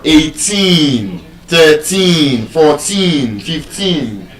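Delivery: put-on each number stressed on a long TEEN; drawn-out endings on "eighteen, thirteen, fourteen, fifteen"; stress on last syllable. Shown as a demonstration. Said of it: "Last syllable".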